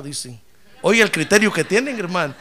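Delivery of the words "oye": ordinary delivery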